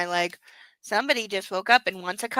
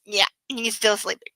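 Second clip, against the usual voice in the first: usual speaking voice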